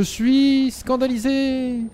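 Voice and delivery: slow, high, drawn-out indignation; mock outrage. Player hams up being scandalized for the chat. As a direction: drag it out in fake shock.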